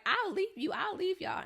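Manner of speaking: mock threat from the angry friend, high and huffy. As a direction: squeak it out like a spoiled friend leaving.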